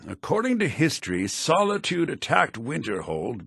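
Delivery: usual speaking voice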